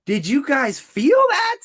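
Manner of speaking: high, drawn-out, stirred question; moved amazement. Real, not acted. Coach to viewers after stirring example, amazed. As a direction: ask it slowly, voice leaping up high. Emotional reaction to own pitch.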